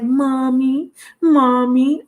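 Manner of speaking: mock little-boy plea; grown man babyish. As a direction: mimic a man whining for mommy. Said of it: "It was a grown-ass man".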